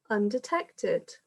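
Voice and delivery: usual speaking voice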